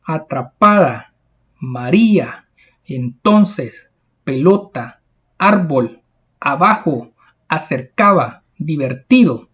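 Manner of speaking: atrapada, María, entonces and the rest, each leaning on the second-to-last syllable; measured word list, penultimate stress as in abajo, divertido. Shown as a demonstration. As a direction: list them one by one, hitting PA in atrapada, LO in pelota. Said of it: "la mayor fuerza de voz en la penúltima sílaba".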